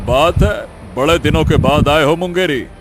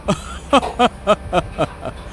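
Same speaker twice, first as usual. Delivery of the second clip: mocking laughter; 3 dB quieter than usual. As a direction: burst of scornful ha-ha laughter. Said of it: "वहाँ खड़े सभी हंसने लगे". (खिल्ली उड़ाती हंसी)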